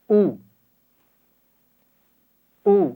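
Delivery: short glottal "او" from the throat; glottal u sound. Shown as a demonstration. Teacher modelling the hamza with pesh, a crisp glottal "او". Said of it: "اس کی آواز ہوگی پیش کے ساتھ".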